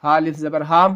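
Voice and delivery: usual speaking voice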